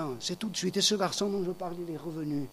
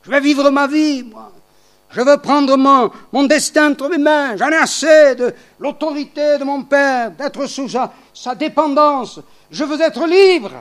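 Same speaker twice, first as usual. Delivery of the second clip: high, loud rebellious cry; defiant young son; 8 semitones higher, 8 dB louder than usual. Retold or imitated. The prodigal son declaring independence from his father, loud and high. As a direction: demand your freedom. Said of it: "C'est comme ça qu'il aurait parlé".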